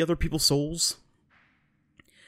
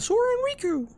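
very high cartoon mouse falsetto; Mickey Mouse; much faster than usual. Mouse king naming the two kids, squeaky falsetto impression. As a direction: answer in a squeaky mock mouse voice. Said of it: "meets back up with Mickey".

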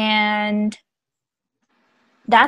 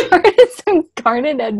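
laughing; 5 semitones higher, 4 dB louder than usual. Shown as a demonstration.

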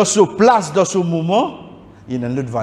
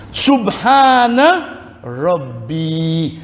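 Subhana Rabbi drawn out very slowly, each syllable held; slow recitation; much slower than usual. Shown as a demonstration. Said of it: "Risque les non-voyelles".

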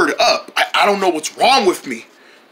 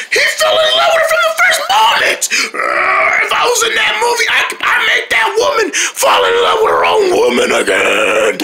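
crying at the movie; 13 semitones higher than usual. Acted. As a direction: wail it out over the film. Weeping over sad movie.

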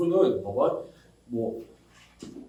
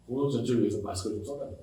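much softer, fast plea, 공동체 with a rise; soft pleading request; 6 semitones lower, 10 dB quieter, much faster than usual. Retold or imitated. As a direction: quietly beg 공동체 전체를 위해서. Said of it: "같은 말 다시". (작고 빠르게 부탁하는 말투)

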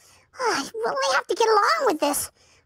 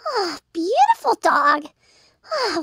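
ordinary delivery

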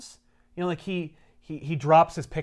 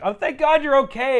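relieved Mary Jane; 11 semitones higher, 7 dB louder than usual. Retold or imitated. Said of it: "she's like".

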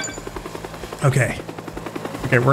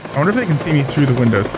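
ordinary delivery

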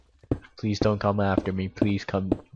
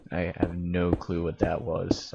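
ordinary delivery

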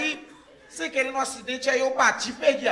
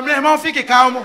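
loud, rising panicked yell at the driver; scared passenger shouting at a reckless driver; alarmed shout; 2 semitones higher, 11 dB louder than usual. Retold or imitated. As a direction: yell at him to focus on the road. Panicked yelling at speeding driver.

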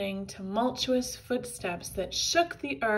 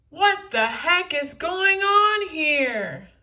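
high, loud, slow angry shout; angry yell; 7 semitones higher, 11 dB louder, slower than usual. A narrator's reading. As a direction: demand it furiously. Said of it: "yelled the angry-looking hero".

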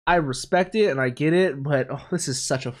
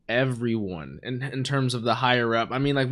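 ordinary talk, nothing marked

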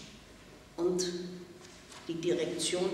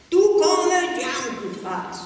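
lauter, viel höher, wütender Schrei; 7 semitones higher, 8 dB louder than usual. A narrator's reading. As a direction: schrei ihr die Beleidigung ins Gesicht. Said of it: "schrie ich". (das Mädchen schreit Pella wütend an)

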